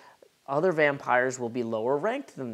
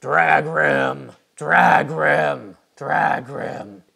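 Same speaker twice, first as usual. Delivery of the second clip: loud, drawn-out monster yell; creature yelling its name; 9 dB louder, much slower than usual. Acted. Hologram dragon yelling its own name, loud creature cry. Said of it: "it immediately starts yelling its name".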